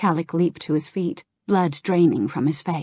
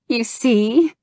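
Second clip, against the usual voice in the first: higher, playful little jab; lightly voiced Kirigosa, amused; 6 semitones higher, 5 dB louder than usual. Kirigosa laughing at Kalik's silly face, playful. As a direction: tease him gently.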